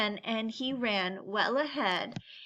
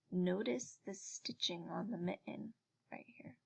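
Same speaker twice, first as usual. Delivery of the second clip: soft whisper, much softer; whispered aside; 4 semitones lower, 10 dB quieter than usual. Real, not acted. Reader whispering an aside about the picture to children. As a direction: lean in and whisper it to the listener.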